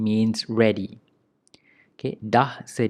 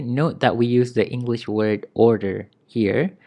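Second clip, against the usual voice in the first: ordinary delivery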